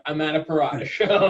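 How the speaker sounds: laughing through the line; amused story opener. Shown as a demonstration. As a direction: chuckle as you say it. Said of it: "most of them start".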